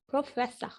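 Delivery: "प्रोफेसर" said as "pro-fessah"; silent R. Shown as a demonstration. Teacher repeating the correct form, "प्रोफेसर" with silent R. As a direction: drop the last R of "प्रोफेसर". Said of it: "नहीं होता".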